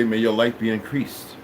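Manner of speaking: low, soft formal greeting; hushed, low well-wishing; calm solemn blessing. Retold or imitated. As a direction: offer a quiet blessing, pitched well down. Second blessing quoted to greeters.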